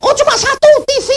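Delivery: very high, fast squeal; retold surprise. Preacher finds only one TV stolen, high comic surprise. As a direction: squeal the discovery quickly, high-pitched. Mock discovery of small loss.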